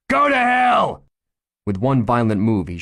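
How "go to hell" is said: high, loud, all-out scream of defiance; furious shout. Acted. Cornered gunman screaming at the chief, furious shout. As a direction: hurl it at him full force.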